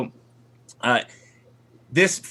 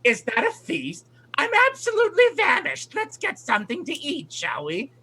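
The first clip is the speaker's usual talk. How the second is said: flighty eccentric aunt. Acted. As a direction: gush about the food like a batty hag. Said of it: "she looks over at the table and goes".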